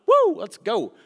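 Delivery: excited shout; hyped. Retold or imitated. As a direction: burst out like a kid ready to run.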